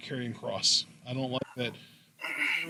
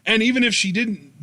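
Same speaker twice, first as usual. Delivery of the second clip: ordinary delivery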